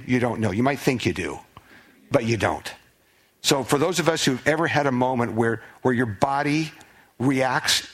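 normal talking voice

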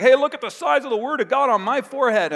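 high, loud bragging show-off; boastful show-off. Pretend. Religious leader bragging about his phylactery, high and loud. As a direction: flaunt it for everyone.

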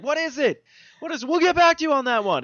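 fleeing the librarian ghost, panicked; panicked quote. Retold or imitated. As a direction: blurt it while running away.

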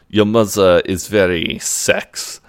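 put-on slow, deliberate French accent; [name]. [name] looks at Will and comments, French accent. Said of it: "you're, you're French".

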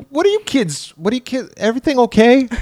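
very high, put-on busybody voice; high-pitched hammed-up concern; nosy rowboat guy. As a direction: check on the kids like an odd stranger. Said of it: "And then he's like".

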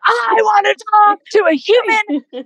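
mock shouting, high and loud; entitled customer. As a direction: yell it like a furious customer. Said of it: "he does that all the time".

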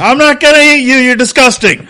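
high, loud disgusted yell; indignant shout. Acted. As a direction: bellow it back in disgust. Monster character denies eating.

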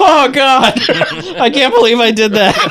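high mock-horrified squeal breaking into laughter; grossed out. Friend imitates hero after icicle kill, comically grossed out. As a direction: recoil in comic disgust, laughing. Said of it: "he's grossed out after he does it".

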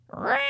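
harsh sudden screech; mimicked piercing infant cry; imitated baby shriek. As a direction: screech like a pterodactyl on fire. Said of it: "this is this kid's cry".

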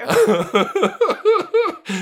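high helpless laughing fit; full belly laugh. Real, not acted. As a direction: break up laughing, can't stop. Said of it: "since I laughed like that on the show".

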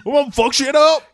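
high, loud pumped-up bro; rally the team like a meathead; gym bro. Acted. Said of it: "a real good Aquaman like".

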